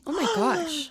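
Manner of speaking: gasp, then a high amazed oh my gosh; genuinely impressed, caught off guard; surprised gasp. Real, not acted. Friend ran six miles.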